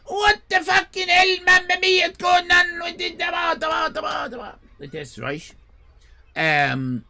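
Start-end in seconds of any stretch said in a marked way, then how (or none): 0.0-1.4 high, loud furious shouting owner; angry Italian owner. Retold or imitated. Restaurant owner storms out yelling at customers. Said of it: "he comes out and he goes". 1.5-2.1 put-on Italian accent, shouted high. Italian-accented owner shouting at customers. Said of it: "he goes". 2.1-4.5 high, loud furious shouting owner; angry Italian owner. Retold or imitated. Restaurant owner storms out yelling at customers. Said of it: "he comes out and he goes".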